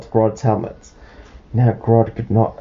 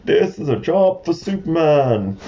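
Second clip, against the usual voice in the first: high, ringing heroic declaration; storybook hero; 7 semitones higher than usual. Acted. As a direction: announce it like a cartoon hero. Hero announcing himself in story.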